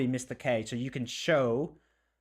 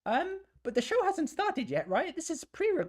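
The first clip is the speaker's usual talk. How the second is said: very high, squeaky put-on voice; [name]. [name] apologising to the boss for lateness, meek falsetto. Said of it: "Can you change your accent?".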